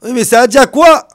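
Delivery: loud, high, impatient demand; high loud pushy question; insistent shout. Acted. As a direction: press him hard for the full name. Host pressing for full name.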